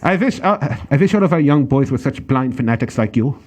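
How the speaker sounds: hammed-up German accent, v for w in wish. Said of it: "How was that?".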